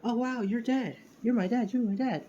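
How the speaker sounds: put-on high-pitched excited little kid; little Deku. Clone child jumps on his dad, high excited kid voice. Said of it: "the little Deku".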